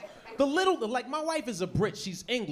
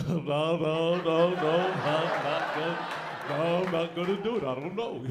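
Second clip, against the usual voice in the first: lower, fumbling refusal; mock flustered American facing an English speaker; dumb flustered American; 5 semitones lower than usual. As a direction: back away from the conversation, flustered. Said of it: "you're like".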